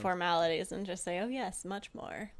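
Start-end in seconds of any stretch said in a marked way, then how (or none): 1.2-2.3 sultry flirt. Acted. Flirting with the departing noble.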